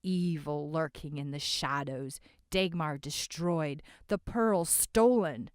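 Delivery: doom-laden warning, slowing; an old man's grim prophecy; ominous hoarse man. A narrator's reading. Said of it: "a hoarse voice replied".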